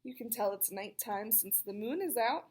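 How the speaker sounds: ordinary delivery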